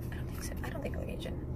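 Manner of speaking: a soft whispered aside to herself; hushed private whisper. Real, not acted. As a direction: mutter it under your breath as an aside.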